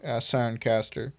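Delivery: ordinary delivery, nothing marked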